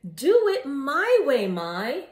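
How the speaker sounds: loud, slow bossy yell. A narrator's reading. Janie yells at her friend Mai to follow her. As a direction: shout it slowly at your friend. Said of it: "yelled Janie".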